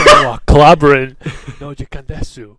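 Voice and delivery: loud shouted catchphrase, breaking into laughter; laughing, hammed-up yell; mock battle cry. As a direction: belt it out as an anime attack call. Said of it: "So do you yell".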